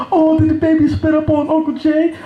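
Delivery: high, cooing baby-talk imitation; cutesy sister. Sister playing up the baby's spit-up, mock cutesy. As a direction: coo it high like a doting mother. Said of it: "play like it's cute".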